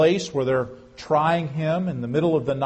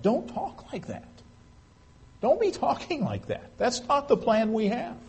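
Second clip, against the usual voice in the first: higher, softer hushed scolding; scolding Peter; 8 semitones higher, 3 dB quieter than usual. Retold or imitated. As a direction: pull him aside and chide him under your breath. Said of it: "chastens him for speaking like that".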